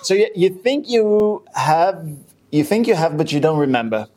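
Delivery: ordinary talk, nothing marked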